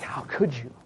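low, soft, hurt little gasp of a question; mock wounded. Preacher mocking how he won't react to critics, faux-hurt. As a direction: play the offended victim mockingly. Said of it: "I'm not like".

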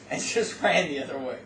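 ordinary talk, nothing marked

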